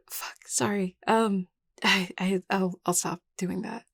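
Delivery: hesitant, self-conscious stammer; flustered apology. Acted. Caught tugging a sleeve, flustered and apologetic. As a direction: apologize in a fluster, tripping over words. Embarrassed at being noticed fidgeting.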